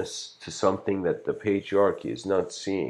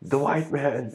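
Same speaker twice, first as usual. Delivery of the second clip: higher, put-on voice echoing; imitating the friend; 8 semitones higher than usual. Second girl echoing her friend, in a mock higher voice. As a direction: parrot the first girl. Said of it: "follows her friend into the battlefield".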